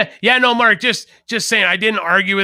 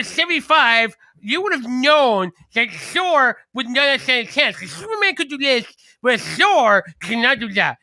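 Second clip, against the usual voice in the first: high, nasal-free prissy know-it-all voice raised well up; pedantic comic nerd; 7 semitones higher than usual. Retold or imitated. Mocking an online comic nerd correcting a stranger, high prissy voice. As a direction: talk down to him like a snooty comic expert. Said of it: "You doing a Casey impression right there?".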